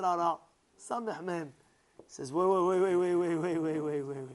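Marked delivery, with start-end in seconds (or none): 2.3-4.3 urgent halting. Acted. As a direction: cut in to halt everyone, then fade away. Heavenly objector halting the gift.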